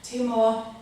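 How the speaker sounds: calm breathing guidance; yoga instruction. Acted. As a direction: lead the class through each breath. Guides a breathing exercise.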